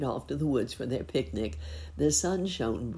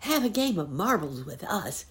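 teasing; 6 semitones higher, 3 dB louder than usual. A narrator's reading. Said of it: "teased Billy Wagtail".

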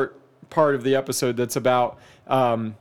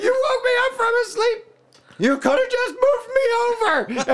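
very high, fast, half-crying whine; a hammed-up woken wife who is outraged; whiny wife. Wife woken by husband, high crying whine.

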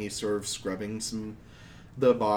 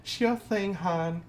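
maid's reply; 8 semitones higher, much slower than usual. Acted. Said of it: "She says".

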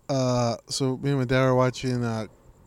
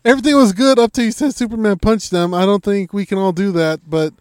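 much higher and louder, half laughing; incredulous loud retort; 7 semitones higher, 8 dB louder than usual. Retold or imitated. Speaker answering GSP's advice, loud and incredulous. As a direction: shout back in disbelief. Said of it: "I was like".

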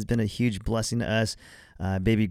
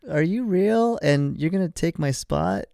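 put-on jealous kid voice; 7 semitones higher than usual. Kids voiced, jealous of baby.

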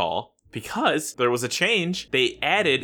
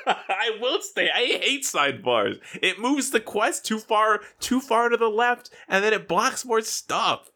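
much higher, half-laughing complaint; high-pitched protest; 11 semitones higher than usual. Real, not acted. Arguing with co-host over screen sidebars, high-pitched protest.

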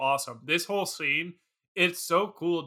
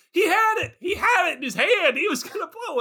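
much higher, loud and giddy, laughing through it; excited retelling; 12 semitones higher, 4 dB louder than usual. Retold or imitated. Retelling Hadley's line about the merman, high and giddy. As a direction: gush like a fan who saw it happen. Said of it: "I like that he goes".

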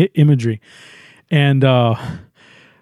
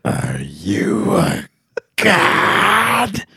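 goddess voice; 7 semitones lower, 4 dB quieter, much slower than usual. Retold or imitated. The goddess challenging Ray atop the building, eerie and drawn out. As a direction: draw it out like an otherworldly being. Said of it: "and then she goes".

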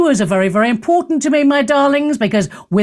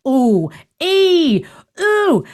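mock pained ooh rising high; wincing ooh; 6 semitones higher, much slower than usual. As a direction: squeal a wincing ooh at a bad song. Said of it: "I go".

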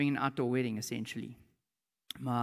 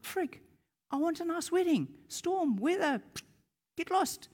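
high-pitched, offhand ordering away; casual command to weather; 13 semitones higher than usual. Acted. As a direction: shoo the storm off in a high voice.

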